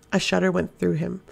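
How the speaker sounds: ordinary delivery, nothing marked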